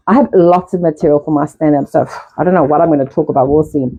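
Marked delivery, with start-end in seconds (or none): none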